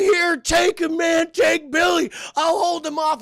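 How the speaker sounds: high, loud soldier's yell; frantic shouting. Acted. Mister Cunningham handing Billy to a comrade, high frantic shout. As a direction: shout orders in a desperate panic. Acting frantic battlefield handover.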